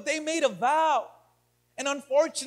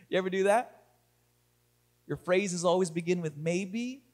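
ordinary delivery

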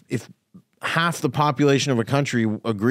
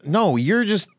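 worked-up retort; 10 semitones higher, 4 dB louder than usual. Real, not acted. Heated rant at critics.

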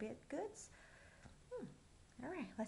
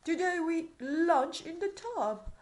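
jolly king declaring; 4 semitones higher, 8 dB louder than usual. Acted.

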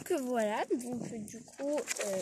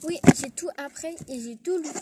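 louder, proud keen answer; eager good pupil; 4 semitones higher, 9 dB louder than usual. Acted. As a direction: show off to the teacher, louder. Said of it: "je vais faire la bonne élève". (bonne élève fière, plus fort)

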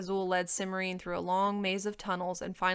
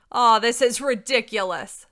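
very loud, higher protest; scornful dragon; 4 semitones higher, 12 dB louder than usual. A narrator's reading. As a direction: boom it out in disbelief.

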